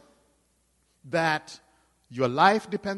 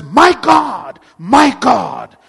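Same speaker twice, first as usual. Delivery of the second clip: crying out; 4 semitones higher, 9 dB louder, much slower than usual. Acted. Preacher voices Jesus crying out.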